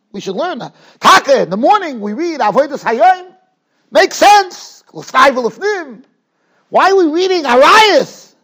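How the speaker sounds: rising preacher's fervor; preaching. Acted. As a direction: drive the point home to the congregation. Rising preaching on Yom Kippur.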